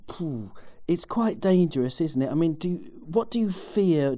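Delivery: usual speaking voice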